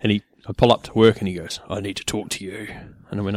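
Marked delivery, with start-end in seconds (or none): 1.7-2.7 much softer mock-boss voice; deep radio voice. Imitating the boss calling him over, deep radio voice. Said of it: "So deep".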